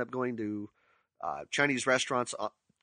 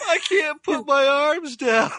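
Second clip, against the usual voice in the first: put-on high, slow whining kid voice, much higher and louder; whiny child; 13 semitones higher, 9 dB louder, slower than usual. Quoting Randy stuck in his snowsuit, whiny child voice. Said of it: "Poor Randy".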